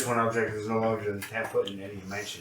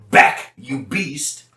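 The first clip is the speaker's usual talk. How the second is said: commanding spell shout; 4 semitones higher, 7 dB louder, much slower than usual. Shown as a demonstration. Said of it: "talk like you mean to cast it".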